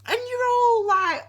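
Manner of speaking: ordinary talk, nothing marked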